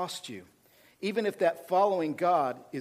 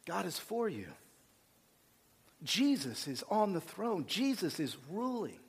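quiet self-talk; 6 dB quieter than usual. Retold or imitated. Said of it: "get weird and start talking to myself".

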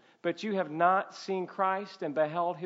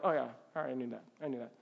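low, soft, dismissive reply; mock indifference; 5 semitones lower, 7 dB quieter, much faster than usual. Imitating churchgoers brushing off talk of the gospel, mock indifferent. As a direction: let it fade away, uninterested.

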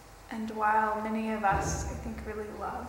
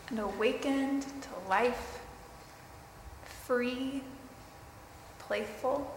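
guided meditation; 3 dB quieter than usual. Acted.

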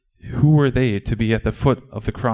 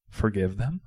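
softer questioning echo, rising at the end; hushed, incredulous question; disbelieving echo; 6 dB quieter than usual. Acted. Disciple echoes Jesus in disbelief.

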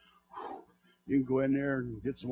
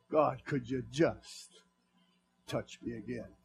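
1 soft slow plea; 5 semitones lower, 7 dB quieter, slower than usual. Pretend. Pleading prayer to God.

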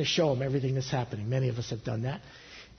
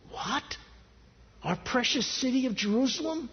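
high-pitched shocked question; voicing a people taken aback; incredulous objection; 8 semitones higher than usual. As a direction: question it in disbelief, pitched well up. People object to God's command.

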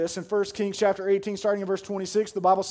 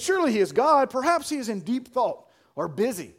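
raised, loud mock musing; taunting; 7 semitones higher, 5 dB louder than usual. A narrator's reading. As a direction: tease them with pretend reasonableness. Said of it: "Elijah began to taunt them".

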